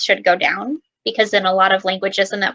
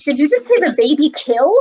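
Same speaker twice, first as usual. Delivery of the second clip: a higher, puzzled, retold question; lightly acted surprise at a dance class; confused question; 5 semitones higher, 4 dB louder than usual. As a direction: replay your own bafflement in a higher voice. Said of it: "I was like".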